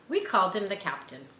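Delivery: ordinary delivery, nothing marked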